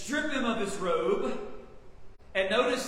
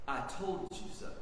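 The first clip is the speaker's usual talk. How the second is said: soft told-you-so; 4 semitones lower, 8 dB quieter, faster than usual. Acted. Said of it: "somewhat of".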